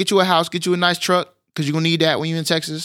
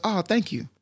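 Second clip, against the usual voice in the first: softer, higher mimicked thanks; naive gratitude; 4 semitones higher, 6 dB quieter than usual. Host playing newcomer thanking the mom, naive gratitude. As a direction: thank her sincerely, unaware of the insult.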